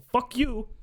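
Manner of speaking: high mocking fuck you; mock sneering insult. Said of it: "they just say".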